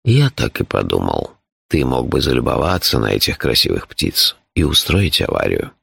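deep, composed character voice; low, measured, matter-of-fact telling; phlegmatic Shurf. A narrator's reading. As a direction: explain danger with unruffled calm.

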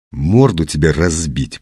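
plain reading voice

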